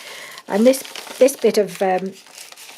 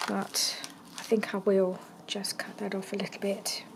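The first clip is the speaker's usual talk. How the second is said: whispering; 13 dB quieter than usual. Real, not acted. Muttering while cutting fabric.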